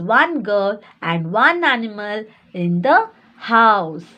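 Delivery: spaced-out, expressive read-aloud; story reading aloud. A narrator's reading. Teacher reading the story's ending to little ones, read-aloud. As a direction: draw out the story's phrases for young pupils.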